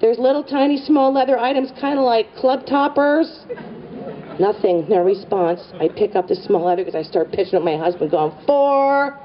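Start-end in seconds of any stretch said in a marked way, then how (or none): 0.0-3.4 high, coaxing call to husband; singled-out, pitched-up invitation; calling over shoulder. Retold or imitated. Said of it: "over my shoulder". 8.4-9.2 high, drawn-out "fore" holler; playful long call; golfer's warning call. Retold or imitated. Golf warning while pitching goods.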